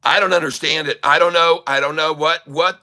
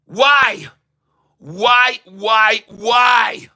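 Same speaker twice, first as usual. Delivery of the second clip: real angry shout, pitched high; exasperated shouting; 4 semitones higher, 3 dB louder, much slower than usual. Real, not acted. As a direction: hammer each word out louder and higher. Furious rant at company boss.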